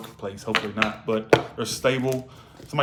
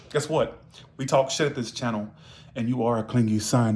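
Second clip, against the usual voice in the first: ordinary talk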